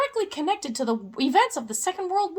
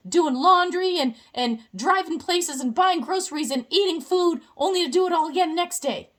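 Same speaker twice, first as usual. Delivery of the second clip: normal talking voice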